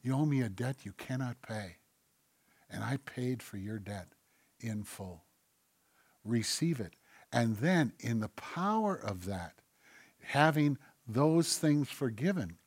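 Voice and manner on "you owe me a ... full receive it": soft, low, unhurried murmur with long pauses; whispering God. Acted. God tells a debtor the debt is paid, hushed whisper. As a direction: speak gently and very quietly, as God to one person. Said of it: "God whispers to you and says".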